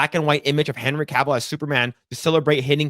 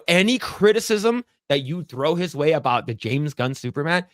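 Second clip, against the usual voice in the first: ordinary talk, nothing marked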